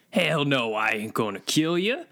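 normal talking voice

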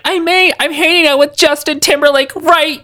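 high, slow, gushing fan voice; starstruck fangirl. Retold or imitated. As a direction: squeal it like a starstruck teen. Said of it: "Jubilee being like".

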